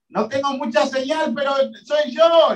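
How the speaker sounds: high, playful call across the storm; joking shout. Retold or imitated. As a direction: call out like a bad phone line. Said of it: "le decía".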